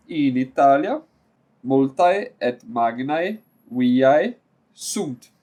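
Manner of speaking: slow, careful reading of a Latin sentence; reading Latin aloud. Acted. Latin teacher reading the textbook line to students, slow and careful. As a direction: read each word clearly for learners.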